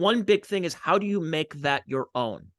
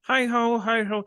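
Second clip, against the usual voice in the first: high froggy puppet voice; squeaky frog puppet greeting; Kermit the Frog; 8 semitones higher, slower than usual. Retold or imitated. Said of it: "I wanna do a Kermit the Frog impression".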